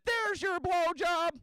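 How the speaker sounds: high, slow mock wife voice; wife's punchline. Comic quoting his wife, slow and high, mock sweet.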